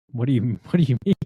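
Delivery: fast, laughing, confused question; laughing disbelief. Retold or imitated.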